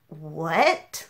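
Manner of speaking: low, soft baffled what; a friend reacting in disbelief; stunned what. Friends hearing the comment, quietly baffled. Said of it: "they were like".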